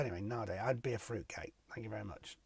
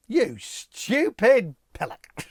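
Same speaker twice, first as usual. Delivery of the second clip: loud, slow, higher hammed-up put-down; mock insult; 6 semitones higher, 8 dB louder, much slower than usual. Presenter mock-insults a buyer in a spoof show, loud and slow. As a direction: drag out the insult like a jokey telling-off.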